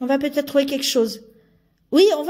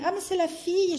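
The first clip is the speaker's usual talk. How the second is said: ordinary talk, nothing marked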